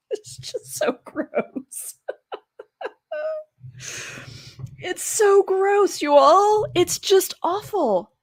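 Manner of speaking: real crying, voice breaking high. Real, not acted. Teacher crying as she reads of the burnings, voice breaking. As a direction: sob through it, barely getting it out.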